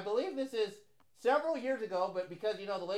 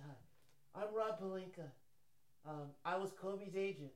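hushed, soft-spoken agent impression; Rob Pelinka; 2 semitones lower, 10 dB quieter than usual. Co-host posing as Kobe's agent, hushed impression. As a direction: introduce yourself very softly, under your breath. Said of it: "He talks like this".

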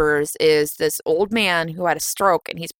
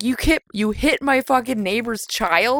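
mock disbelief; 5 semitones higher than usual. Imagines friend's reaction to confession.

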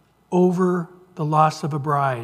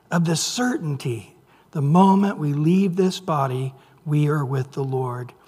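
ordinary talk, nothing marked

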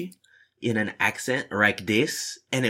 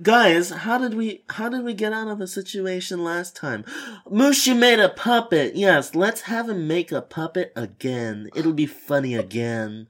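mock excited executives; 6 semitones higher than usual. Mocking Disney writers' meeting.

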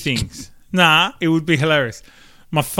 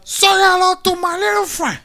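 put-on high, loud Cuban gangster accent; Tony Montana quote; 7 dB louder than usual. Hacker pulls out his apple peeler, loud high Cuban gangster accent. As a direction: belt the famous line like Tony Montana. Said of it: "Tony Montana".

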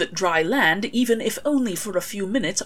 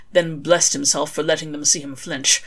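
ordinary delivery, nothing marked

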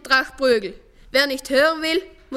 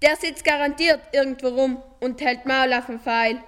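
ordinary delivery

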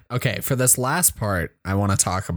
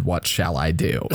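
mock-formal theatrical line, lower and laughing; hammy stage actor; 8 semitones lower than usual. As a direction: ham up the old-fashioned question. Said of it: "I doubt a kid said".

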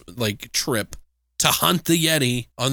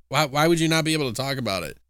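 normal talking voice

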